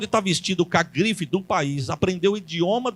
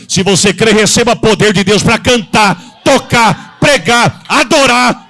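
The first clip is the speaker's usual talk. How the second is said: loud, climactic preacher's cry; shouting sermon, building to its peak; full-force preaching; 4 semitones higher, 10 dB louder than usual. Acted. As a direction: drive every word home to the congregation.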